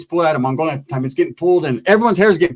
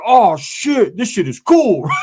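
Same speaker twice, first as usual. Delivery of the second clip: excited buddy; 5 semitones higher, much slower than usual. Retold or imitated. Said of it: "he's like".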